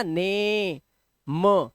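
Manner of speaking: ने held long, then म added as a separate hummed "m"; sounding out name. Shown as a demonstration. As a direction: break the word into a long ने and a separate म. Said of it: "ना पे ए की मात्रा ने". (ने को खींचें, फिर म अलग से)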